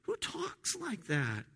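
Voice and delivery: slow, high-pitched incredulous question; offended disbelief. Acted. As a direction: draw it out, scandalized and incredulous. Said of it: "it's offensive".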